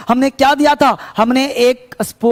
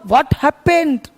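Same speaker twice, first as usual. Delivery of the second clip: "What happened?" drawn out very slowly, falling at the end; teacher spelling out "What happened?" at a crawl; slow demo; much slower than usual. Shown as a demonstration. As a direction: say "What happened?" slowly so students can copy it. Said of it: "तो क्या हुआ?".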